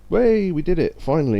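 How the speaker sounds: loud, high victory cheer; excited cheer. Real, not acted. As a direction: cheer like you finally won. Finally completing the order.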